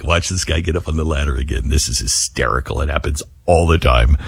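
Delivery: mocking trees. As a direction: voice the trees laughing at the guy on the ladder.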